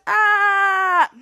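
loud, high held yell; long pretend cry of panic; mock fleeing scream. Kids run out the doors, mock fleeing scream.

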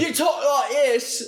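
very high, drawn-out mock British accent. Shown as a demonstration. Showing off to co-host the British learned for a play, hammed-up British accent. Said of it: "I learned how to be British".

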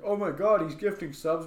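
high, loud mock squeal of delight; excited chatters. Viewers in chat reacting to gift subs, hammed-up excitement. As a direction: play a thrilled stream viewer. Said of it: "People will be like".

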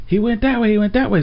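high, urgent imitated cop; urgent pointing out. Cop directing another officer after runner, urgent. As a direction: repeat it urgently, pitched well up.

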